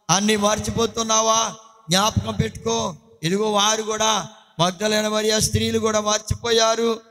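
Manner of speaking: ordinary talk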